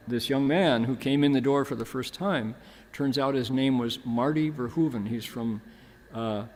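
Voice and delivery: ordinary delivery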